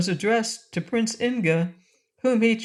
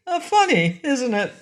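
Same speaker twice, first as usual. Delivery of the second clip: jolly fat king; 8 semitones higher, 4 dB louder than usual. Acted. Jolly king's catchphrase.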